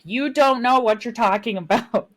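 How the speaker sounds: high, loud mock tirade; unfiltered tired rant. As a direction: rant at them with no filter, pitch climbing to a shout. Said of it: "there's no filter at that point".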